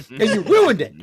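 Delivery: high, loud hammed-up blame; mock outraged accusation. Mocking officer over ruined plans, hammed-up outrage. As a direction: blame them at full volume, mock fury.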